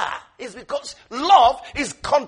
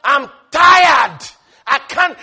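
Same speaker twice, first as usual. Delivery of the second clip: exasperated complainer; 7 dB louder than usual. Retold or imitated. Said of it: "He's not busy saying".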